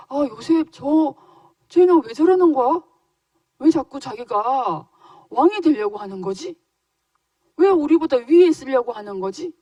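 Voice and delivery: exasperated brothers grumbling loudly. Acted. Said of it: "이러면서 막 화가 난 거예요". (짜증 내며 화난 형들 연기)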